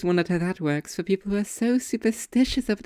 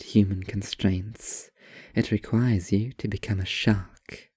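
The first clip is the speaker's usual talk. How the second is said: low deadpan writer; 8 semitones lower than usual. Acted. Writer lecturing the waitress, low and mock-grand. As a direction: deliver it like a self-serious artist.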